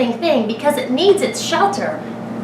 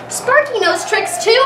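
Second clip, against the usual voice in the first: insistent; 8 semitones higher than usual. A narrator's reading. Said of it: "I told her".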